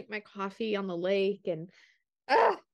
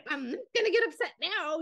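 higher, laughing through the line; 8 semitones higher than usual. Retold or imitated. Retelling her pledge to the husband, laughing. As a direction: giggle it out as a joke on yourself. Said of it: "I'm competitive".

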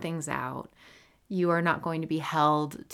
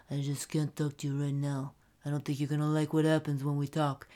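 mafia voice. Shown as a demonstration. Host demonstrating a silly way to decline a call, mafia voice. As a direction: play a mafia boss giving a veiled threat. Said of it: "mafia voice".

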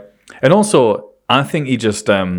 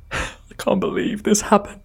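high, breathy mock distress; hammed-up disbelief of the innocent; forced emotion; 9 semitones higher than usual. Suspect feigning shock to the policeman, forced emotion. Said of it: "I can just force out the emotion".

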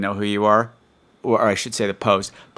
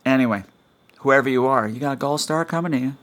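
ordinary delivery

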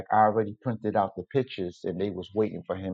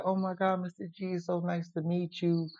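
high, soft voice imitating a delighted hostess; imitated gushing woman's greeting; 8 semitones higher, 7 dB quieter than usual. Daughter greets the visiting photographer, imitated gushing welcome. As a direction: put on a much higher, softer welcoming voice. Excited greeting from Sama, acted.

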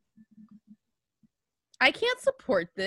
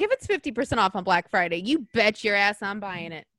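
ordinary talk, nothing marked

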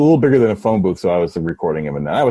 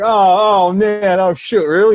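high, loud dismayed "oh man"; disappointed surprise; 8 semitones higher, 5 dB louder, slower than usual. Retold or imitated. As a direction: sound crushed by the news. Reacting to sold out.

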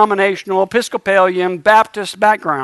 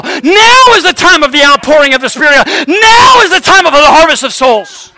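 higher and louder, building preacher's cry; full-force preaching; 6 semitones higher, 4 dB louder than usual. Acted. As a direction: rouse the congregation, rising to a shout.

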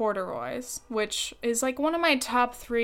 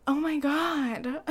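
gasp of surprise; 5 semitones higher, slower than usual. Real, not acted. Catches her own slip.

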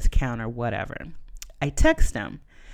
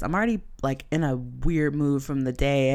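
normal talking voice

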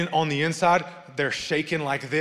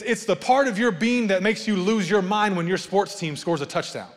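ordinary delivery

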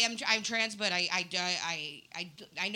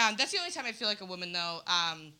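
usual speaking voice

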